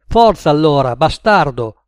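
loud furious challenge; angry shout. A narrator's reading. Ad yelling at Nick to fight, loud. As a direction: bark the final dare.

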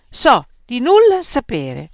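student insisting loudly to the impatient examiner. Acted. Socrates repeats, insisting.

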